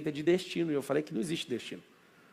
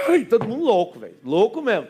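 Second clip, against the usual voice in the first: laughing excitedly; 6 semitones higher, 9 dB louder than usual. Real, not acted. Recalling the wild excitement, laughing.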